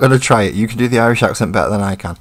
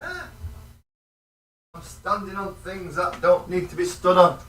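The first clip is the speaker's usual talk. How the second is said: higher, softer voice from off in a cupboard; away from the mic; 9 semitones higher, 12 dB quieter than usual. Real, not acted. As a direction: call it out faintly while rummaging away from the mic. Off rummaging in the cupboard.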